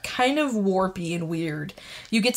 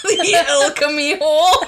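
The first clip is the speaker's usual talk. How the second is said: high laughing shout; 7 semitones higher, 6 dB louder than usual. Real, not acted. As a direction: blurt it out through laughter. Laughing at the toilet joke.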